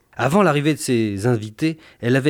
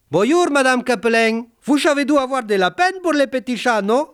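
put-on high Spanish maid voice with heavy accent; 12 semitones higher, 3 dB louder than usual. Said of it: "demanda la servante ibérique".

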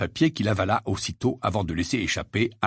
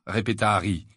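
plain reading voice

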